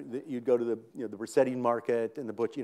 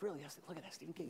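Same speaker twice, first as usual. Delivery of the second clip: hushed; 4 semitones higher, 17 dB quieter, faster than usual. Retold or imitated. Wife whispers in the drugstore.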